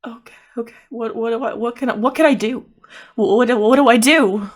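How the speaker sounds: acted helplessness, tripping over the words; flustered panic. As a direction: start small, build to a loud plea. Distraught character begs for something to do.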